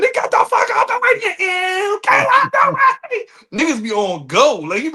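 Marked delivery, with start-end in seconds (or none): 0.0-3.3 high, shouted put-on voice, much higher and louder; imitating a hurried northern driver yelling at traffic; mock road rage yell. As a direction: bark it like an angry driver stuck in traffic.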